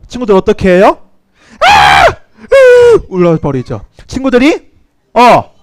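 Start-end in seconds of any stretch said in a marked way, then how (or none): 1.6-3.1 mock terrified shriek rising high; acted ghost-house scream. Teacher playing a child in a haunted house, scared shriek. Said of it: "귀신이 딱 나타나".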